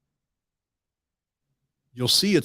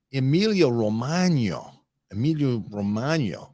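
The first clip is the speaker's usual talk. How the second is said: Emilia Romagna with Italian vowels, ending in o; Italian accent; 2 semitones higher than usual. Shown as a demonstration. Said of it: "John would be mad with my Italian accent".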